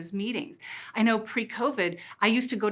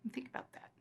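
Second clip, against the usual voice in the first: breathy whispered aside; a hushed nudge to reflect; 4 semitones higher, 18 dB quieter, faster than usual. Real, not acted. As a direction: lean in and whisper it.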